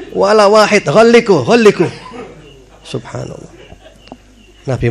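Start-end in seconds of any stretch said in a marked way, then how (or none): 0.1-1.9 mock angry outburst. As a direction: play the hothead demanding revenge, over the top. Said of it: "Kalau kita gitu". (luapan marah yang dibuat-buat, lebih tinggi dan keras)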